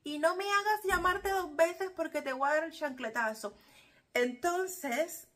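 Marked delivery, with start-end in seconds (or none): 0.0-3.5 put-on higher, louder threat to the kids; playful imitation of a shouting mother; mock scolding yell. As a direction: warn them like a fed-up mom. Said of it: "te meten el grito".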